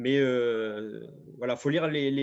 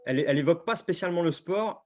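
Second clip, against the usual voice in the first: normal talking voice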